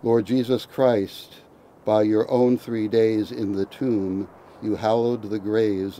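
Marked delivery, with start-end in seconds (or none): none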